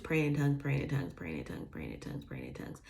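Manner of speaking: fading away to a murmur; fading repetition. Retold or imitated. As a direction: let it trail off softly, over and over. Repeats, fading into prayer.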